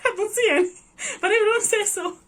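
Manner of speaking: high, loud and giggling; bubbling over with laughter; giggly excitement. Real, not acted. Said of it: "I get too excited talking about books".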